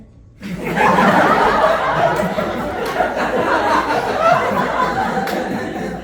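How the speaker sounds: very loud high long laugh; real loud laughter; huge laugh. Real, not acted. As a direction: howl with laughter at your own line. Huge laugh at own joke.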